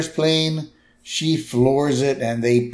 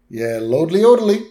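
higher, quick chirpy sign-off; Flanders' voice; 5 semitones higher, 4 dB louder, faster than usual. Retold or imitated. Flanders talking to a dead line, quick and chirpy. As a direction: rattle it off cheerfully. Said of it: "Flanders is like".

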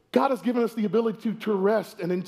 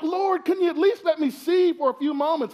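higher, whiny mock gripe at the sun; hammed-up irritated plea, pitched up; angry complaint to God; 7 semitones higher than usual. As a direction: complain to the Lord like a spoiled kid. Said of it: "I get angry".

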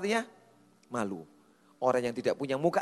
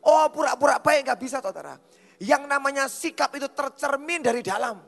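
high, loud pulpit proclamation; forceful preaching; 13 semitones higher, 9 dB louder than usual. Real, not acted. Preacher tells congregation attitude comes from within, forceful preaching. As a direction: drive the point home loudly to a congregation.

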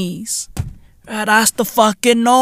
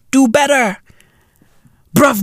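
ordinary talk, nothing marked

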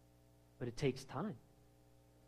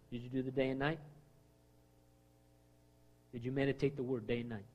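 usual speaking voice

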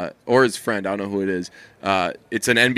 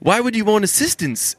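high-pitched mock New Zealand accent; 10 semitones higher than usual. Quoting a podcaster questioning the assistants, New Zealand accent. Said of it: "it sounds so awesome with New Zealand accents".

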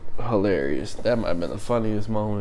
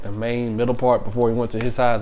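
ordinary talk, nothing marked

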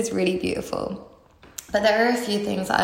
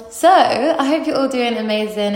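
ordinary talk

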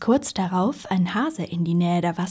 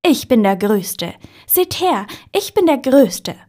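higher, louder proud call; loud, proud boast; 5 semitones higher, 9 dB louder than usual. A narrator's reading. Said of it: "laut und stolz hinabzurufen".

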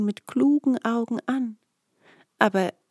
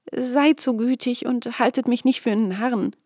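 shaky breathless pleading; 5 semitones higher, 4 dB louder than usual. A narrator's reading. Peter pleads with hidden Glasmann.

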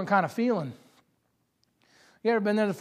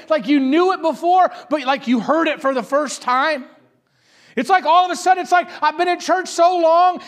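louder, higher preaching voice pressing each line; preacher lifting the congregation with rising fervour; full-force preaching; 9 semitones higher, 8 dB louder than usual. Real, not acted. Preacher urging the church, at full force.

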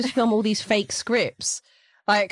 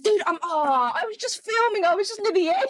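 flustered excuse; 13 semitones higher than usual. Retold or imitated. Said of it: "I'm like".

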